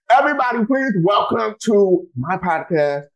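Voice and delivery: high, slow announcer-style intro; excited welcome. Real, not acted. Host welcoming a guest to her podcast, high and slow. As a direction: roll out the welcome like a big host.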